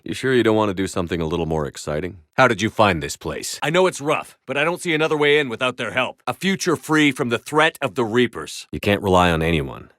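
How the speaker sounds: normal talking voice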